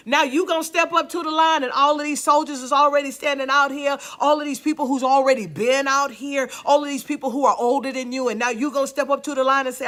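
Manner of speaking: loud, fast, rising sermon delivery; building preacher's fire; preaching at full force. Acted. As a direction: drive it home to the congregation.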